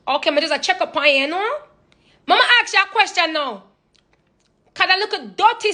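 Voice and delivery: high Jamaican patois mother checking in; a Jamaican mom working up to a question. Retold or imitated. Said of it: "My mom will ask me".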